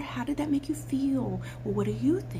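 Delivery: soft whispered therapist questions. Retold or imitated. Coach mocking therapists, soft whisper. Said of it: "Therapists are there".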